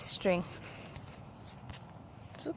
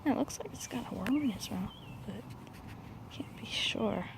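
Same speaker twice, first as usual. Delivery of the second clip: whispering; 3 semitones lower, 14 dB quieter than usual. Real, not acted. Hushed so bird won't fly.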